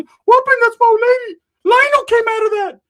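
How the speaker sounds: very high, loud squeaky voice; Ricky's squeaky voice. Retold or imitated.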